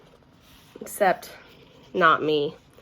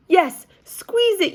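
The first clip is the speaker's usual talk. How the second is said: high, loud, slow chant; yelled; 12 semitones higher, 6 dB louder, slower than usual. A narrator's reading. Children yelling at May to squeeze it, high and loud. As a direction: yell it all together. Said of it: "yelled the children".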